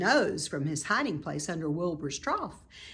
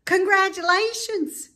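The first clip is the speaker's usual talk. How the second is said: much higher, louder shout; Wilbur shouting; 8 dB louder than usual. A narrator's reading. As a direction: call it out eagerly. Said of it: "shouted Wilbur".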